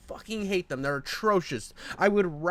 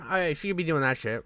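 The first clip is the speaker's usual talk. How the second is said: usual speaking voice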